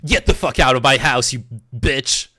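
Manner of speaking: loud mock-angry outburst; imitated furious order, pushed loud; Andrew Tate. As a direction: throw them out with hammed-up outrage. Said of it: "And then Tate is like".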